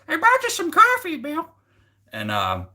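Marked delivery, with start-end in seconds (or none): 0.1-1.5 very high, squeaky cartoon imitation; absurdly high-pitched caricature; exaggerated brother-in-law voice. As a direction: push the mock voice way up into falsetto. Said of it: "that's the way he talks".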